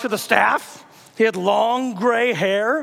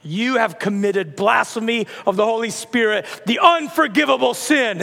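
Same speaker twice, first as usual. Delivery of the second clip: condemning prophet. Acted. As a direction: pass judgement, building to the final phrase. Prophet's curse builds to climax.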